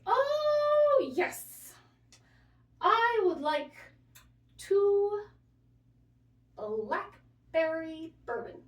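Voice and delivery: put-on high, loud, slow elderly-man voice; aphasia patient. Nurse retells patient ordering breakfast, high slow mock old man. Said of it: "And he goes".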